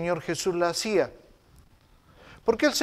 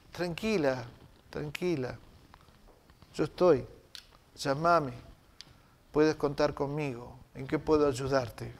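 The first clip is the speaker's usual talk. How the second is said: soft, calming words to someone in crisis; calm her down gently; soothing reassurance; 4 semitones lower, 8 dB quieter than usual. Pretend. A sister soothes someone in crisis, soft and reassuring. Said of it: "Tan tranquilizadora".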